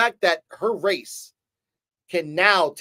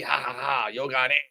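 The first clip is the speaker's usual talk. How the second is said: lower, softer swaggering Spanish villain; cocky conqueror impression, pitched down; mock confident boast; 5 semitones lower, 5 dB quieter than usual. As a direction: gloat in Spanish with a staged laugh. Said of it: "goes up all confidently".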